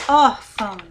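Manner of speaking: "órfão" with a loud ÓR; stress on first syllable. Shown as a demonstration. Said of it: "qual que é a mais forte?".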